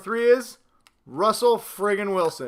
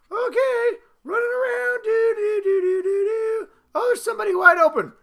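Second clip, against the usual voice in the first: much higher, a mocking dainty put-on voice; prissy quarterback; 14 semitones higher than usual. Vlogger mocks NFL quarterbacks with a doll, high dainty mock voice.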